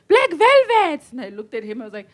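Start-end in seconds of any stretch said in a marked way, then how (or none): 0.1-1.0 high, loud street call. Retold or imitated. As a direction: call out a nickname from across the road. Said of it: "the guy stops, like".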